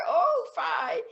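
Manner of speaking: high, loud squeal of protest; mock outrage. Sue to Jim after his kiss, high mock-outraged protest. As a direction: squeal it as a scandalized sweetheart.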